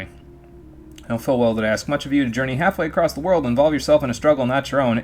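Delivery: formal, livelier letter-reading voice; formal in-game letter narration; letter read aloud. A narrator's reading. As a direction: read out a solemn plea with lifted energy.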